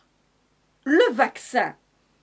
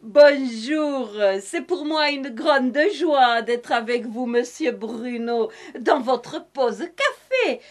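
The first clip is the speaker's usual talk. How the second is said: lightly put-on southern French accent with its sing-song lilt; a touch of southern French accent, hammed up for comedy. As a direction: play a cheerful Madame Masque with a hint of southern French accent. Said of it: "je prends cet accent du Sud".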